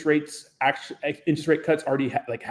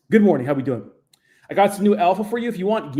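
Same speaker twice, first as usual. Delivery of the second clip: usual speaking voice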